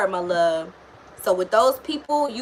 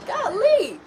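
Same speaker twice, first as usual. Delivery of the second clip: exasperated; 12 semitones higher than usual. Real, not acted. Exasperated exclamation.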